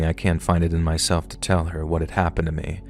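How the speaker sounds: usual speaking voice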